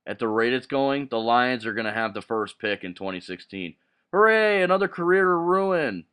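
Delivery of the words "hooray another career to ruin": a mock hooray, pitched higher and louder; sarcastic cheer. Real, not acted. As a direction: fake celebration, dripping with sarcasm. Sarcastic cheer over a losing team.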